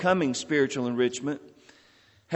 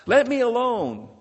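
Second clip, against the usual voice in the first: higher, mildly raised-voice protest; mock yell; 8 semitones higher, 4 dB louder than usual. Teacup protesting to its master as it is rolled, mock yell. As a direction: cry out in protest, without a full shout. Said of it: "I yelled out".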